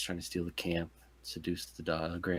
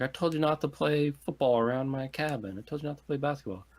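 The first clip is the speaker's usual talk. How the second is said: mock scolding; 7 semitones higher than usual. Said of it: "He's like".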